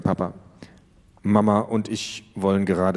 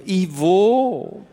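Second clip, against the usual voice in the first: put-on teen voice; 10 semitones higher, 4 dB louder than usual. Teen greets neighbor.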